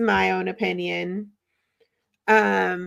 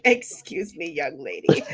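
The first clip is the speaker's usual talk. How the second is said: lightly voiced stern mother scolding; a hint of a strict parent; stern nun. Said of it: "I would have been like".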